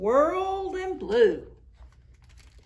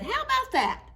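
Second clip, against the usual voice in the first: put-on very high delighted kids' voice; children's voices; faster than usual. A narrator's reading. The children admire the snowman's outfit, very high. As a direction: chirp it like pleased children.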